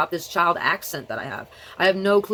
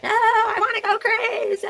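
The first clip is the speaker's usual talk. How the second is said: wild squeal; slower than usual. Pretend. Bursts out against boring delivery.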